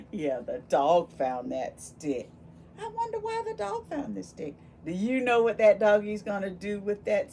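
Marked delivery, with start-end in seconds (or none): 2.8-4.5 high, fast wondering voice. Acted. Teacher wondering aloud to children, high and quick.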